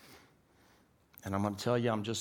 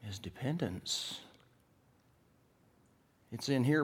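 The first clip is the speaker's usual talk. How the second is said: ordinary talk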